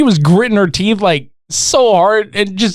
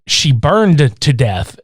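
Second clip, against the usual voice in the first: slow solemn; 3 semitones lower, much slower than usual. Retold or imitated. Teacher quote.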